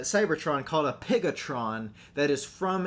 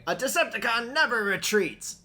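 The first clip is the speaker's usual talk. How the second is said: higher, proud boastful voice; Starscream impression; 9 semitones higher than usual. Quoting Starscream.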